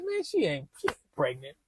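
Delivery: lightly voicing his laid-back mother; a son imitating his mom dismissing the pregnancy in a low, soft voice; mom's voice. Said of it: "She said".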